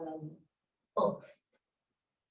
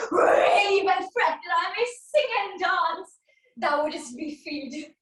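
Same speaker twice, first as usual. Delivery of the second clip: ordinary delivery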